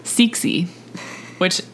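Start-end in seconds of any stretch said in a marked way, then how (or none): 0.0-0.7 Cixi said as "SIK-see"; pronouncing Cixi. Shown as a demonstration. Host demonstrating the name Cixi, carefully said "sick-see". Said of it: "it's pronounced".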